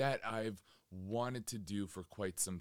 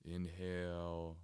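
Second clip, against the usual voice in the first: measured, settled delivery, letting each phrase hang in silence; guided meditation; 3 semitones lower than usual. Real, not acted. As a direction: guide listeners calmly inward, phrase by unhurried phrase.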